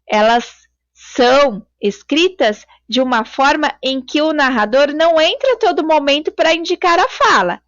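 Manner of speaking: usual speaking voice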